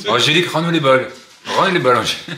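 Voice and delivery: loud playful call-out; mock demand. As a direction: call out to an absent friend to give it back. Mock plea to absent Angélique.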